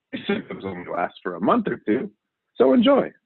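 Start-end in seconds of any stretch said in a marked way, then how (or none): 2.6-3.1 cheery sign-off. Acted.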